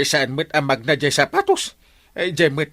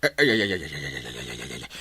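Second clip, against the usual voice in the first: groans sinking lower and softer to nothing; fading despair; 13 semitones lower, 9 dB quieter, faster than usual. Acted. Captain despairing over stolen laundry, fading groan. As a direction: let the lament die away.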